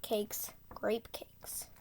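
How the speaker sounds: breathy whispered words; hushed whisper. Shown as a demonstration. Whispered twister practice.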